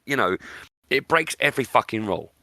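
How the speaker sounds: normal talking voice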